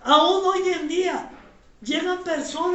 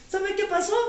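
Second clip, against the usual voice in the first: normal talking voice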